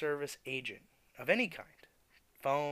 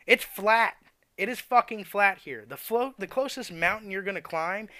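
exasperated rant; 7 semitones higher, 6 dB louder than usual. Real, not acted. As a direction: spell out the obvious, fed up. Ranting about Florida AWD buyers.

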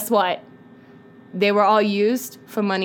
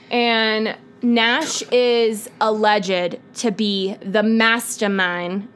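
slow, spaced-out mock gravity; put-on dramatic delivery; slower than usual. Naming the alleged mastermind, slow put-on drama. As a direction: draw out every word for mock drama. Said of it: "Why am I doing that?".

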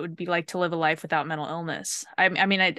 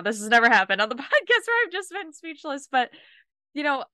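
higher, laughing through the words; laughing, blown away; 7 semitones higher than usual. Real, not acted. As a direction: laugh in amazed disbelief. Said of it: "I'm just blown away".

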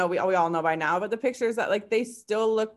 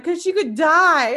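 high, slow, loud teary wail; crying to husband that the puppy could die; mock tearful wail; 8 semitones higher, 9 dB louder, slower than usual. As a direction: wail it like a crybaby.

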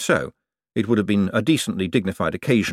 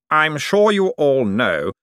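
slow, raised public-speaking voice; formal address; 6 semitones higher, 3 dB louder, slower than usual. A narrator's reading. Cosmo resuming his speech to relatives, slow formal address. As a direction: address the room deliberately.

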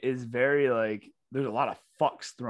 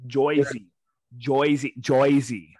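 loud, mocking New Jersey accent; hammed-up Jersey vowels; 6 dB louder, much slower than usual. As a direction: punch out an exaggerated Jersey "Joyzy". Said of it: "the Joyzy accent".

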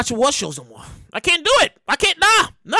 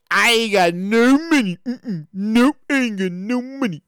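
slow, lower, stretched-out words; drawn-out plea; 3 semitones lower, slower than usual. Shown as a demonstration. Host joking about being broke, drawn-out plea. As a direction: drag it out low and slow.

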